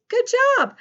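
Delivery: high, loud cheer of approval; delighted teacher praise; bright praise. Pretend. Praising the imagined pupils.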